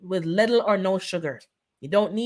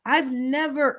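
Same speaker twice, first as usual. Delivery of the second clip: ordinary delivery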